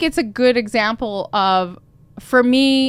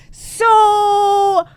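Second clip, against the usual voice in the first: long, high, loud "so"; sing-song lead-in to bad news; drawn-out awkward opener; 12 semitones higher, 9 dB louder than usual. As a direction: stretch it out high and loud before confessing. Breaking bad news to detailer.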